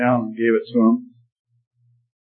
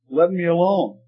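raised pitch in mild protest, not shouted; an understated cry of objection, pitched up; mild objecting yell; 6 semitones higher than usual. A narrator's reading. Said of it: "I yelled out in objection".

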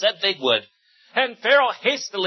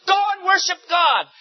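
higher, louder king's command; 11 semitones higher, 3 dB louder than usual. A narrator's reading. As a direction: call it out as a king. Pharaoh calling out.

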